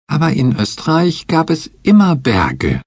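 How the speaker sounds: put-on high, insistent girlish voice; high child digging in her heels; insisting Betsy. A narrator's reading. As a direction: insist stubbornly, high-pitched. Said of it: "beharrte Betsy".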